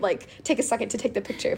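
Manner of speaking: usual speaking voice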